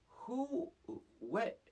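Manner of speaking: high, incredulous questions; bewildered disbelief. Retold or imitated. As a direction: sound utterly baffled by the movie. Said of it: "what am I watching".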